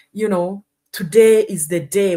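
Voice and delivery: usual speaking voice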